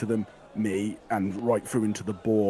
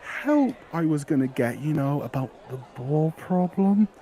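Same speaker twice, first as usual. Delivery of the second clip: put-on higher boyish voice with nervous laughs; 7 semitones higher than usual. GM as the lad explaining to his dad, nervous laughing boy voice.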